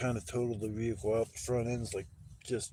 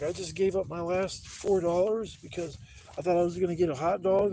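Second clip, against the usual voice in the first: high, louder aggrieved protest; exasperated complaint; 6 semitones higher, 5 dB louder than usual. Retold or imitated. Cheated customer complaining to the vendor about lost money, exasperated. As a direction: plead the injustice, retelling it worked up.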